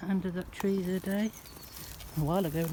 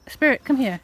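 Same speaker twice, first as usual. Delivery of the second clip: loud call, higher; loud, raised call to a pet; calling the dog; 6 semitones higher, 10 dB louder than usual. Real, not acted. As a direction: call out to the dog across the churchyard.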